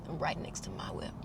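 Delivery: hushed whisper of dread; whispered dismay. Real, not acted. Realizing the roommate parks beside her, whispered dread. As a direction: drop to a whisper in disbelief. Dismayed aside about the car.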